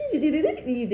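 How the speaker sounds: a possessed shriek breaking into laughter; hammed-up demon wail; shrill mock cackle. Mimicking demon reaction.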